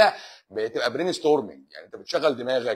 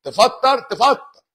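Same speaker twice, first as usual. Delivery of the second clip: high teasing yell; 11 semitones higher, 9 dB louder, much slower than usual. Retold or imitated. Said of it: "يقول له".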